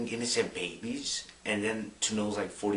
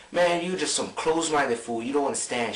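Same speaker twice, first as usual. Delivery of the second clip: louder, higher mock rebuke; imitated angry scolding; 6 semitones higher, 6 dB louder than usual. Imagined defender scolds a close-minded friend, mock-angry raised voice. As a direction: act out an exasperated put-down. Acts defender insulting the objector.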